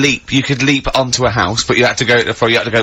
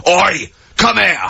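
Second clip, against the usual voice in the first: amplified shout; 6 semitones higher, slower than usual. Pretend. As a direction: yell it like through a built-in mic. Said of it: "You can go".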